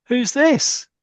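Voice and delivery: high, loud mock astonishment; startled question. Players spotting a second Father Christmas, startled. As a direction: voice shoots up in surprise. Startled characters see another Santa.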